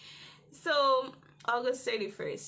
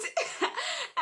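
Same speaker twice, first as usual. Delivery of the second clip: high giggle. Real, not acted. After retold prayer.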